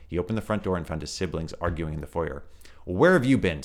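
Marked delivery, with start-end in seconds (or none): 2.9-3.6 louder, higher irritated snap; an older brother snapping at the latecomer. Acted. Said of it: "snapped Peter".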